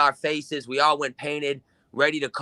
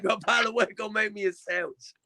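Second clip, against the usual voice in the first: mock female jab; 8 semitones higher, 5 dB quieter than usual. Repeats the Living Dead girl's jab.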